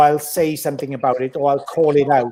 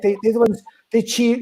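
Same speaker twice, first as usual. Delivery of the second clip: ordinary delivery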